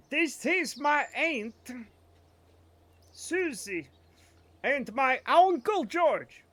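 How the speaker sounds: slow textbook introduction of aunt and uncle; slow demo sentence. Shown as a demonstration. Streamer demos the family words to chat, slow textbook style. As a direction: introduce them slowly like a language tape. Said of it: "demo sentence".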